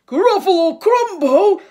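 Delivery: high, loud yelp; terrified Gruffalo. A narrator's reading. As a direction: recoil in horror. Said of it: "the Gruffalo said".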